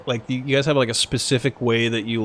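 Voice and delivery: ordinary talk, nothing marked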